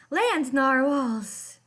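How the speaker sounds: loud and very slow, thrilled; drawn-out cry of joyful wonder; excited discovery. Acted. Kelp stumbling out of the forest, calling out thrilled.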